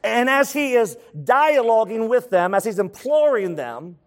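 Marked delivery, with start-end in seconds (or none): none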